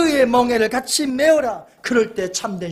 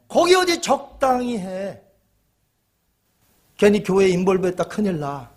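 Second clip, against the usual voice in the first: ordinary talk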